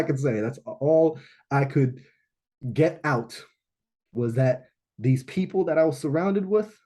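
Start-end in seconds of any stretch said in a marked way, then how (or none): none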